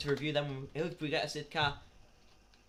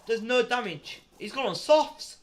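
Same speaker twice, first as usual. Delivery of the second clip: excited; 6 semitones higher, 7 dB louder, slower than usual. Real, not acted. Surprise at a tyre choice.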